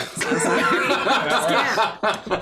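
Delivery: giggling through the words; laughing apology. Real, not acted. Game master laughing at failing the accent, real giggle. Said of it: "'Cause I just can't do that".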